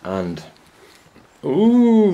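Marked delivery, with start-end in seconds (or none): 1.4-2.1 sudden high loud yelp; a startled high squeal; high ooh. Real, not acted. Vlogger startled while filming tomatoes, a loud high ooh. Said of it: "I'm scaring yous now".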